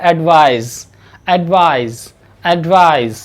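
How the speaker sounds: एडवाइज said slowly with a buzzing z at the end; teacher drilling एडवाइज with a final z; advise with z. Shown as a demonstration. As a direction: repeat एडवाइज, ending on a clear z sound. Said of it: "इसका pronunciation जेड के साथ होगा".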